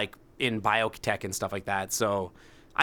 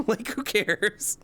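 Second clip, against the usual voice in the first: high and slow, laughing through it; 8 semitones higher, much slower than usual. Real, not acted. Streamer laughing off work panic, laughing. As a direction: laugh it off, drawn out.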